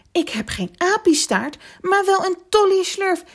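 high, loud gleeful announcement; thrilled little elephant calling out; happy shout. A narrator's reading. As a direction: exclaim it with rising joyful excitement. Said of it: "riep hij blij".

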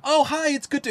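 1 cheery greeting. Retold or imitated. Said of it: "Somebody on the way in is like".

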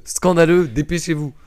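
scolding shout; mock yelling at listeners. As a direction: yell it at the audience, half joking. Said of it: "gueuler sur son audience".

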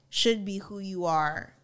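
normal talking voice

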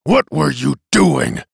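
loud, slow, gruff demand. A narrator's reading. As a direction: interrogate a crewman roughly, drawn out. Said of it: "asked Bailey gruffly".

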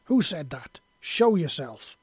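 loud, challenging demand; snarl. A narrator's reading. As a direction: snarl at an unseen intruder. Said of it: "Yelena snarled".

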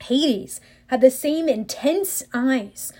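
ordinary delivery, nothing marked

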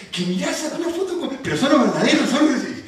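put-on high, laughing kid voice; excited kids. Preacher imitating his kids gushing over the photo, laughing. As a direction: squeal like an excited child. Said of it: "Estaban como locos".

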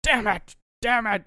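loud, high exasperated yell; crazed outburst. Retold or imitated. Woman alone outside cursing the night, crazed yell. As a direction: yell it out like someone losing it. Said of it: "crazy person's it, outside and goes".

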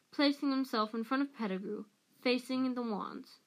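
ordinary delivery

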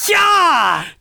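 high, loud German "ja" shout; German-accent cheer. Acted. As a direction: cheer loudly like a comic German.

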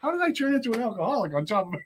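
high-pitched comic exasperation; mock dismay. Recovering addict asks himself about becoming an alcoholic, in mock dismay. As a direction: wail it in mock disbelief at yourself.

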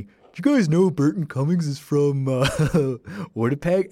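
playful teasing question, rising at the end; joking quip. Retold or imitated. Student teasing the teacher about Winnipeg, playful and mock-innocent. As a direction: tease the class with a grin.

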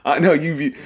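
ordinary talk, nothing marked

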